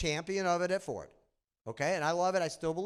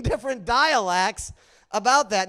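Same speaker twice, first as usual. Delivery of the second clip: ordinary talk, nothing marked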